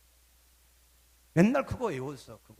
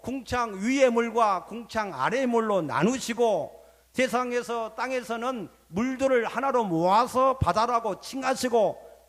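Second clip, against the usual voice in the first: raised, driving sermon cadence; forceful preaching; 6 semitones higher, 4 dB louder than usual. Real, not acted. Preacher recounts creation to congregation, forceful preaching. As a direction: build the list of creation acts in a high preaching voice.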